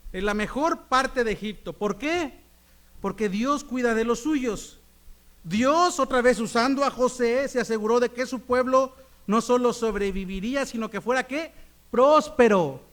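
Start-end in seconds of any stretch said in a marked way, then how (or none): 5.4-12.8 loud, rising sermon voice; full-force preaching. Acted. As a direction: preach it out to the church.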